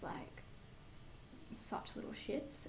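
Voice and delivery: slow unvoiced insult; whisper. Real, not acted. Calling some kids little shits, slow whisper. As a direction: whisper it like a secret. Complaining about bratty kids.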